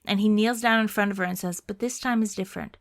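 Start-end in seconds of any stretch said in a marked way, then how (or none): 1.6-2.8 soft, subdued goodbye; tender farewell. Acted. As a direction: say it quietly to someone drifting off. Joshua kneels, says goodbye.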